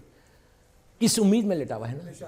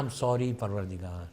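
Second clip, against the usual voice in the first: sheepish apology; 8 semitones lower, 8 dB quieter, slower than usual. Acted. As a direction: lower and much softer, a little slow. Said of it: "دیکھیے یہ میرا style ہے".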